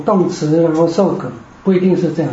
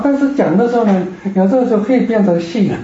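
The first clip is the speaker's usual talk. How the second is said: usual speaking voice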